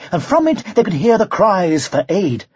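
usual speaking voice

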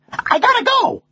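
very high parrot voice; anxious to go. A narrator's reading. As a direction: break off in a high-pitched bird voice. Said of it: "anxious to go".

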